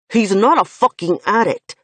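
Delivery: loud, hot-tempered protest; furious denial. Acted. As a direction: spit it out in anger. Finn flares with anger.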